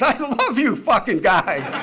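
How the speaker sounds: slow, high, hearty mock proclamation; elated priest outburst. New priest stands up to address the dinner guests, elated outburst. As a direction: belt out the punchline, stretching it out.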